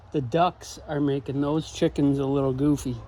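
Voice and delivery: ordinary delivery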